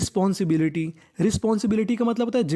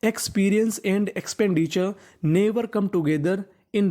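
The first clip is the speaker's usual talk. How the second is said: each word spaced and drawn out; teacher reading the full sentence very slowly; very slow model reading; much slower than usual. Shown as a demonstration. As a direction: read the whole sentence slowly for pupils. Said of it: "तो पूरा sentence बना हमारा".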